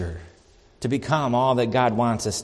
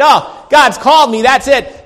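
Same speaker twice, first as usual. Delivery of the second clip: mock complacent voice; 11 semitones higher, 13 dB louder than usual. Mocking a complacent belief.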